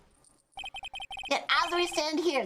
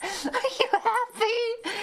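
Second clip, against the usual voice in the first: high, slow, wailing through laughter; laughing; 10 semitones higher, much slower than usual. Real, not acted. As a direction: crack up mid-question. Laughing repeat of the question.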